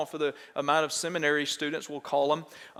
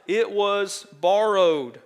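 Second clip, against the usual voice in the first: drawn-out, louder anguished cry, slowed right down; cried out; 3 semitones higher, 6 dB louder, much slower than usual. Acted. Preacher voicing the student's cry over the lost axe, slow and loud. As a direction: wail it out slowly like a man who lost a borrowed tool. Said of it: "He cried out".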